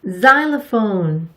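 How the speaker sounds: Xylophone said very slowly with a Z start; drawn-out Xylophone, zy-lo-phone; English word demo. Shown as a demonstration. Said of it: "X로 시작하는 단어는 거의 없답니다".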